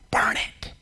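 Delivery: hissed secret whisper. Acted. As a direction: breathe it like a conspiracy. Enemy whispers the plan.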